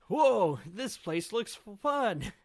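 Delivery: high, loud gushing whoa; excited wow. Acted. Luffy spotting the training ground, high excited whoa. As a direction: burst out with delight. Straw Hats rush off excited.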